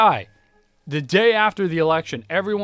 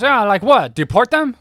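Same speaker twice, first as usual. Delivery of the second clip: loud sarcastic punchline; sarcastic jab; 8 semitones higher, 9 dB louder than usual. Retold or imitated. Old man mocks the other, loud sarcastic jab. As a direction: land the jab with scorn. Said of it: "He goes".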